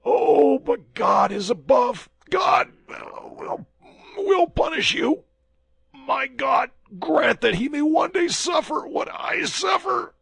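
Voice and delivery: high, loud voice of a poisoned man in agony; poisoned soldier accuses D'Artagnan, anguished and high; dying man's accusation. Acted. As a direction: cry out betrayal through the pain.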